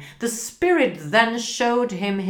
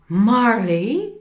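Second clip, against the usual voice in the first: startled cry; 4 semitones higher, 6 dB louder than usual. Acted. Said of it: "cried Scrooge".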